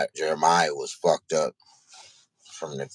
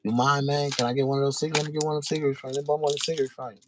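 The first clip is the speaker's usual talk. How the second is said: high, fast, friendly ask for a cigarette; polite young hustler asking; 9 semitones higher, faster than usual. Retold or imitated. Doobie asking the crackhead for a cigarette, high and polite. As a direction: sweet-talk a stranger for a smoke, quickly. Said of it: "he was like".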